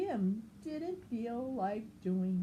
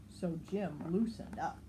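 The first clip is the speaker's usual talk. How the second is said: usual speaking voice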